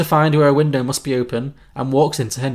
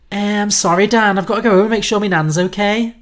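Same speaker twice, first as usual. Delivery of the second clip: higher-pitched Scouse daughter making excuses; Scouse accent; 6 semitones higher, 4 dB louder than usual. Acted. Red tells Dan she must check on Nan, Scouse accent. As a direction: apologise in a higher Liverpool accent. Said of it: "so the note is Scouse accent".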